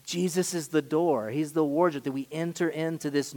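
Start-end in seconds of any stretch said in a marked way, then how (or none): none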